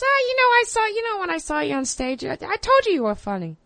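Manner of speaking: high-pitched mock comic voice; Bill Burr. Guest retells a comic praising her stage joke, high impression. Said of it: "Bill Burr told me a long time, he goes".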